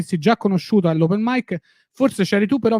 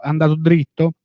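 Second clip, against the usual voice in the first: ordinary talk, nothing marked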